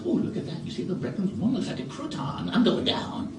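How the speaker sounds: high, light hungry bird voices; chirpy birds. Acted. Birds eyeing breadcrumbs, high bird chatter. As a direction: chatter like greedy little birds.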